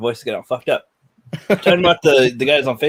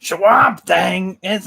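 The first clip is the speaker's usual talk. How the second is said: loud, high, drawn-out put-on voice; failed impression; 5 semitones higher, 5 dB louder, much slower than usual. Trying a voice he can't do, loud drawn-out mimicry. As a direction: attempt the voice, slow and loud. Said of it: "I can't do his voice".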